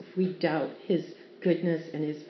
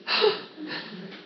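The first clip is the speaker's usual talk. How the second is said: high gasp; 11 semitones higher than usual. Retold or imitated. Baby reacting to needle.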